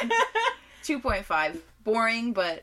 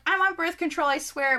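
higher, mock-earnest girlfriend voice; imagined girlfriend quote; 8 semitones higher, 3 dB louder than usual. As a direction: play a girlfriend swearing it's true, pitched up. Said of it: "Or she's like".

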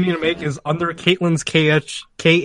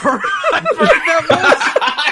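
high, slow, laughing hard; giggling through the words; 11 semitones higher, slower than usual. Real, not acted. Laughing reaction.

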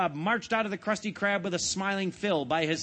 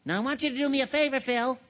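eager SpongeBob; 6 semitones higher, faster than usual. Acted. SpongeBob asks a favor.

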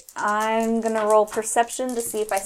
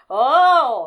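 loud, high drawn-out "oh"; yelling; 6 semitones higher, 9 dB louder than usual. Acted. Player's character yells on hearing the dog, loud cry. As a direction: belt out an excited yell. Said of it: "gonna start yelling, just like".

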